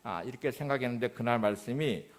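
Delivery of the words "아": usual speaking voice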